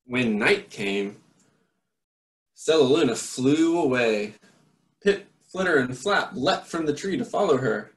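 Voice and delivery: put-on lively read-aloud voice for children; story narration. A narrator's reading. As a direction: read it like a bedtime picture book. Reads a picture book aloud.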